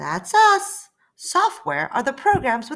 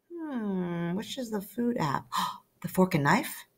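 much softer wondering; quiet searching; 2 semitones lower, 7 dB quieter than usual. Acted. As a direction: hunt for the app under your breath. Searching aloud.

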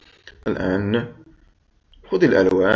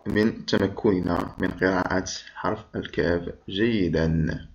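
ordinary delivery

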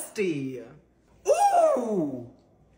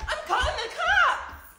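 ordinary delivery

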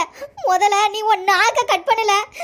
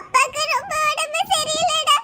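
crying; 6 semitones higher than usual. Acted. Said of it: "தயவு செஞ்சு அழாத".

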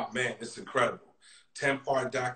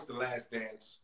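mumbled; 13 dB quieter, slower than usual. Real, not acted. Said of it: "Wait, say that again?".

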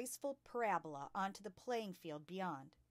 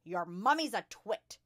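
loud, scornful bellow; 2 semitones higher, 10 dB louder than usual. A narrator's reading. Headmistress insults a pupil's mother, bellowing. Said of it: "the Trunchbull bellowed".

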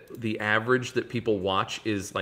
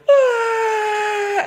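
long, loud, high-pitched scream; mock death scream; 7 dB louder than usual. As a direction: scream as loud as you can like a bad actor dying. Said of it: "keeps going".

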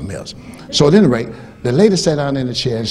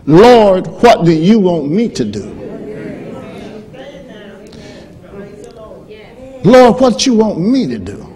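proclaimed plea; 7 semitones higher, 3 dB louder, slower than usual. Shown as a demonstration. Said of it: "here's what that says".

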